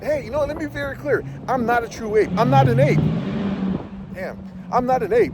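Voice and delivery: high, heated denial; pushing back hard and high; emphatic. Real, not acted. Heated rebuttal to critics.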